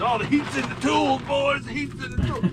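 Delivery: high mock old-timer voice; old timers' saying. Coworker mimicking the old heads, mock high voice. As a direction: ham up the old heads' wisdom. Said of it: "all them old heads".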